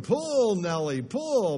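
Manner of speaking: hammed-up farmer shouting his horse on; farmer yelling at horse. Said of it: "the farmer started yelling".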